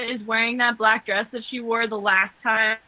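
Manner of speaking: usual speaking voice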